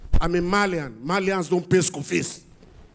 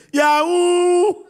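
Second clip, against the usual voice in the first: excited shout; 10 semitones higher, 6 dB louder than usual. Acted. Son answers with scam slang.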